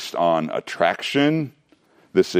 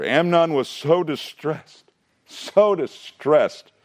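mock melodrama; 8 semitones higher, slower than usual. Sermon mocking Amnon's lovesickness.

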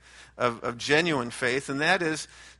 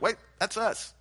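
surprised realization; 7 semitones higher than usual. Retold or imitated.

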